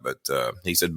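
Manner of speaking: usual speaking voice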